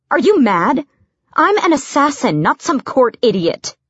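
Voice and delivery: indignant. A narrator's reading. Assassin pulling away from the servant's pinch, indignant. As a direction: protest sharply, pitch jumping up.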